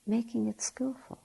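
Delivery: usual speaking voice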